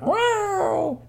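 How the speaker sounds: high drawn-out meow; cat meow. Acted. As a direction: answer like an eager cat. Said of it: "saw Cute Bones and went".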